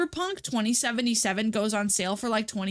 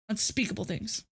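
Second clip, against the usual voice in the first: ominous; 5 dB quieter than usual. Pretend. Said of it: "unspeakable things repeated".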